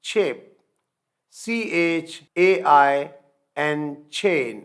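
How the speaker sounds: slow letter-by-letter spelling of chip and chain; slow spelling drill. Shown as a demonstration. Teacher spelling words out slowly for students. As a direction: spell it out slowly, then the word. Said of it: "voice less sound آتی ہے، چھ".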